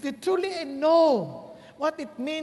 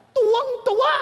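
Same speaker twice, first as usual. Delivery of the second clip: high and loud delight; 11 semitones higher, 9 dB louder than usual. Retold or imitated. Preacher showing how overjoyed the listener was, high and loud. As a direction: cry it out full of joy.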